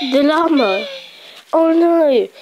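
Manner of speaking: high alarmed cry. Real, not acted. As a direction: panic at the realization. Realizing llama in danger.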